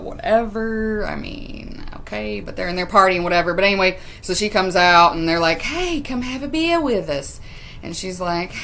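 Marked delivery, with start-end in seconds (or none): 5.6-7.4 high, softer put-on Australian accent; lilting mock Australian invitation. Partygoers inviting Hannah for a beer, put-on Australian accent. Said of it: "I'll stop doing the accent now".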